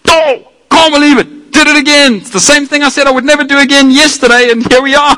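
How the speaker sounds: high, wailing mock lament; hammed-up crying over his own sin, pitched high; mock whiny self-reproach. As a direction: whine like a sinner caught again. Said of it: "I'm like".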